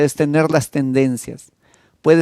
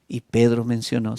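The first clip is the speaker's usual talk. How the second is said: ordinary talk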